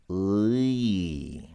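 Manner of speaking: ы drawn out as a slow glide from "uh" to "ee"; isolated, lengthened ы for learners; ы as two sounds. Shown as a demonstration. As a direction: stretch ы to expose both vowels. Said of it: "it's not one sound".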